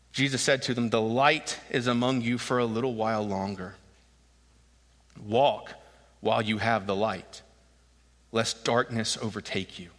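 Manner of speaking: solemn verse read aloud; scripture reading. A narrator's reading. Re-reads the verses.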